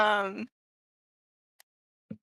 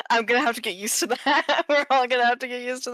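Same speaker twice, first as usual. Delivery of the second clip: laughing; 4 semitones higher, faster than usual. Real, not acted. Laughing at own fumble.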